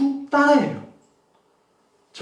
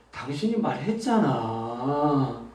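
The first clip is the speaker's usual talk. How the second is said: low, soft, slow, with a long drawn-out 잖아; like a last plea; 8 semitones lower, 6 dB quieter, slower than usual. Shown as a demonstration. Coach showing the softest version, last-plea feeling. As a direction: stretch the ending into a gentle appeal. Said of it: "마지막 요청 같은 느낌이 들어요".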